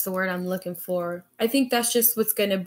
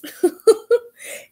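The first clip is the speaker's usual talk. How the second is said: high, louder giggle; nervous laugh; 13 semitones higher, 7 dB louder than usual. Real, not acted. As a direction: laugh off the nerves. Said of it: "nerve-wracking knowing that you're watching me".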